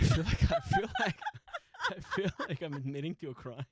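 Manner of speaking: talking through helpless laughter; real laughing. Real, not acted. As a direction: crack up while getting the words out. Cracks up while confessing.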